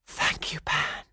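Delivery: breathy whispered thanks. A narrator's reading. Rescued girl thanking her god, whispering. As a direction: whisper it in relief. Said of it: "she whispered".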